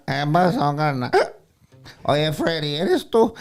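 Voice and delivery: put-on high, loud old man voice; Don Pepe. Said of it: "Don Pepe".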